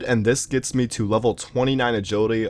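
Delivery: usual speaking voice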